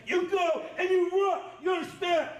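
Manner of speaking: barked orders at full volume; drill sergeant shouting. Real, not acted. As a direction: yell it like a drill instructor. Drill instructor yelling.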